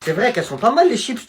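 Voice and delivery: usual speaking voice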